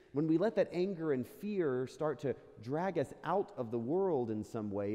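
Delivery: normal talking voice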